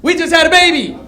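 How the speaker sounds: high, loud proud announcement; proud shout. Real, not acted. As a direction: shout it out to the crowd. Shouting the big news.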